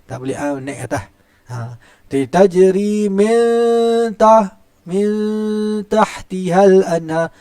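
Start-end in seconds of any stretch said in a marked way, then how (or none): 2.1-7.3 Tajri min taht drawn out very slowly, high and loud; teacher showing the mistake of going too thick; wrong thick recitation. Shown as a demonstration. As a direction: chant it too heavily on purpose. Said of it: "Naik tinggi".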